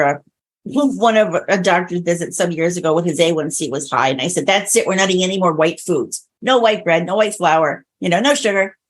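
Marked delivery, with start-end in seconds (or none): none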